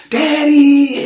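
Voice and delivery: high, loud squeal of joy; excited child shout. Retold or imitated. Daughter surprised to see her father walk in, shrieking. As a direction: scream it out like a thrilled kid. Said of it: "And she".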